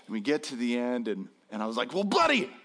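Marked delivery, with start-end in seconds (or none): 1.9-2.5 mock upbeat dad; forced cheer. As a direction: chirp it to save the moment. Dad trying to rescue the moment.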